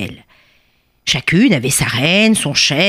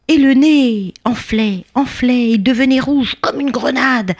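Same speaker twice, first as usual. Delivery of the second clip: dramatic swelling; 7 semitones higher than usual. A narrator's reading. Storyteller describing the king's stung nose to children, dramatic swelling. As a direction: build the swelling with stretched, higher words.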